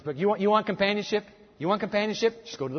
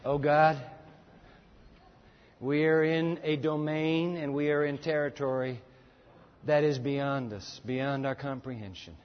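prayer; 4 semitones lower, 4 dB quieter than usual. Acted. Pastor praying for standing congregation, hushed reverent prayer. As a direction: pray softly for the standing church.

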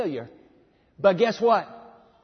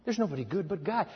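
quiet hanging question; 6 dB quieter than usual. Retold or imitated. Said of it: "he lets that question just hang".

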